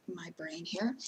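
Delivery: whispered aside. Real, not acted. Aside about her brain.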